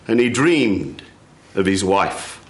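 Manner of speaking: ordinary delivery, nothing marked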